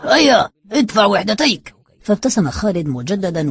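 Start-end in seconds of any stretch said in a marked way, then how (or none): 0.0-1.7 loud, raised demand; rough shout. A narrator's reading. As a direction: call out forcefully, pitched up. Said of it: "أوقفه أحدهم بعنف وصاح".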